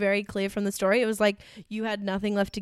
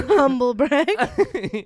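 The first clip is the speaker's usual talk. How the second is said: louder, slowed, laughing through the words; laughing tease; 3 semitones higher, 6 dB louder, much slower than usual. Real, not acted. Co-host teases friend about dining alone, laughing.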